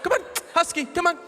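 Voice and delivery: very high-pitched pet-coaxing voice; wife coaxing animal. Retold or imitated. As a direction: imitate a woman sweetly calling a dog, way up high. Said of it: "calling a coyote".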